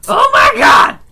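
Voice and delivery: high startled yell; startled shout. Acted. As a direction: shout it in sudden panic. NPC startled by intruders.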